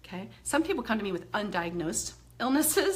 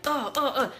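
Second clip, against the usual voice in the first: demo anger grunts; 4 semitones higher, 5 dB louder than usual. Shown as a demonstration. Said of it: "This is my anger point".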